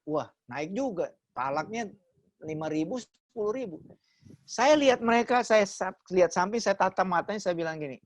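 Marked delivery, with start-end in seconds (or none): none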